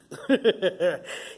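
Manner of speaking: loud, high burst of laughter; real laugh. Real, not acted. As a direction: crack up at your own joke. Laughs at own tweaker description.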